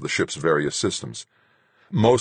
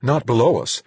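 higher, louder alarmed protest; startled, raised-voice objection; startled cry; 8 semitones higher, 4 dB louder than usual. A narrator's reading. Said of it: "Duchamp cried, startled".

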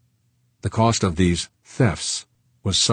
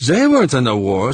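higher, louder French voice with heat; heated Frenchman; 7 semitones higher, 7 dB louder than usual. Acted. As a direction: push back hotly at Fuchs. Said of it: "said Saint Clair with some heat".

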